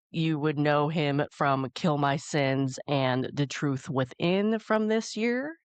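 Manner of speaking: ordinary talk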